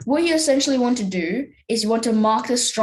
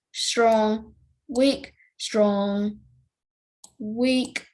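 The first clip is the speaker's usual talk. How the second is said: usual speaking voice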